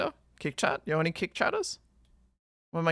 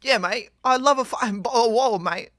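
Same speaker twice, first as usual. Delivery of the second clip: loud, hammed-up British lad, higher pitched; mock British accent; 7 semitones higher, 9 dB louder, faster than usual. Streamer mimicking a Brit asking for water, hammed-up British accent. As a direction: put on a broad British accent, swallowing the Ts. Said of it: "If I was British, I'd say".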